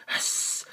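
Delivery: soft unvoiced hissing; snake hiss. Snake menacing the president, snake hiss.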